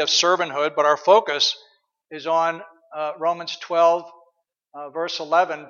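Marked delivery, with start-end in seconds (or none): none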